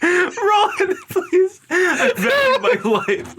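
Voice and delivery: high, loud, laughing mock begging; laughing plea. Podcaster begging the movie to end, laughing high and loud.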